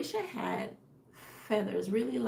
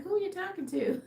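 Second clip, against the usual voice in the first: a hint of a higher-pitched dog voice; dog's imagined reply; 8 semitones higher than usual. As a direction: lightly voice the puzzled dog. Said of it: "he's looking at me like".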